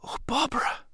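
breathy whispered cry, high; barely a breath, full of joy; gasped whisper. A narrator's reading. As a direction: overcome whisper of the name. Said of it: "I gasped".

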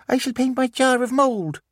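put-on high small-boy voice; a young boy asking eagerly; child voice. Miles asks Artemis, in a high child voice.

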